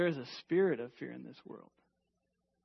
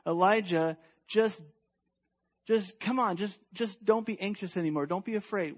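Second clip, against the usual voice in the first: mock dismissive rebuke; 4 semitones higher, 5 dB louder than usual. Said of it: "He doesn't say".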